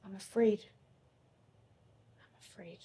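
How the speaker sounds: unvoiced, trembling admission of fear; afraid whisper. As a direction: barely get the words out, afraid. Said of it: "You want me to act it out?".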